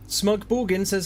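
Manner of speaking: normal talking voice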